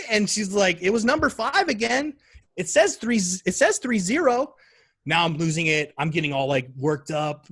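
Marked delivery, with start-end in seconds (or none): 0.8-4.5 put-on high-pitched child's score report; imitated child, pitched up; excited little girl. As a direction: report it like an eager small kid. Daughter reporting another goal.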